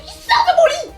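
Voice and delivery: yelling. Real, not acted. Sister yelling at brother.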